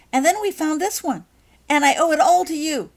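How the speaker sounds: high and loud thanks; overflowing with gratitude; grateful excitement. Acted. Becky thanking Holly for her new wand, high and excited.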